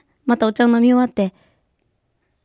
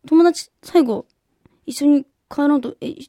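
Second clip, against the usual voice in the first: a hint of a puzzled mother; 5 semitones higher, 5 dB louder than usual. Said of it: "って言うから".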